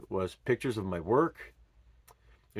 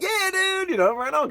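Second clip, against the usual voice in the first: much higher cheering cry; celebrating; 4 dB louder than usual. Retold or imitated. Father cheering his son's skateboard drop, high and excited. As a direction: whoop it up like a proud dad.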